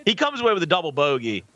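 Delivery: mock golf broadcast play-by-play; hammed-up golf commentary; sports commentator. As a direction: call the shot like a TV golf announcer. Streamer does mock golf commentary.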